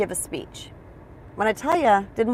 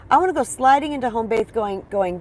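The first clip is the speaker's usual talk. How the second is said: usual speaking voice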